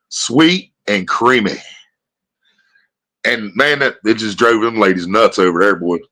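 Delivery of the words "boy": ordinary delivery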